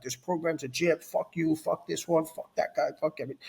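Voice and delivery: cursing everything, running out of steam; retold angry rant. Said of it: "I was pissed".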